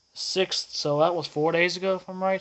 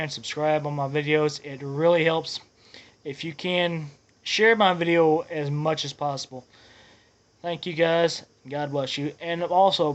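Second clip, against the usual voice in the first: ordinary talk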